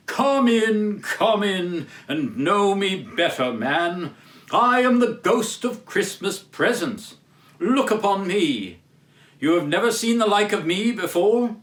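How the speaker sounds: a grand, hearty spirit welcoming Scrooge; booming giant spirit. Acted. Ghost of Christmas Present speaks.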